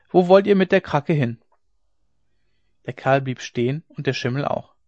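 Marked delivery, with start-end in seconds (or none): 0.1-1.4 raised, louder question called across; calling out. A narrator's reading. Rider asking a horse dealer where he's headed, calling out. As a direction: shout the question over to him. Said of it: "rief ich".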